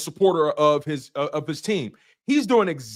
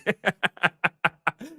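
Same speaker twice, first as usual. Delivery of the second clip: laughing. Real, not acted. Laughs at own jab.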